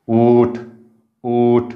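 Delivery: उठ with the उ drawn long and the ठ clipped; long u, cut t. Shown as a demonstration. Teacher to class, showing उठ with a long उ and clipped ठ. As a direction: stretch उ in उठ, stop short on ठ. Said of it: "अपूर्ण उच्चाराचं आहे आणि हे लांबट".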